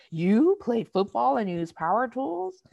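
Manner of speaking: high, loud, drawn-out incredulous question; mock disbelief. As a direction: imitate someone astonished, stretching the words upward. Quotes disbelieving remark made to women.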